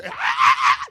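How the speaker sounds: snake scream. Acted. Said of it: "and it just starts".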